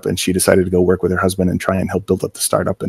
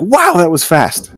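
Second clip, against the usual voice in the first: higher, slow amazed wow; mock amazed boss; 10 semitones higher, 3 dB louder, slower than usual. Boss sees the finished helmet drawing, slow amazement. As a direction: stretch out the surprise. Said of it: "he's like".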